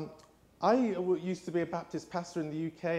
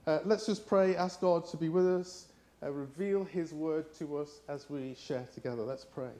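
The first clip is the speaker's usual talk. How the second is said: ordinary talk, nothing marked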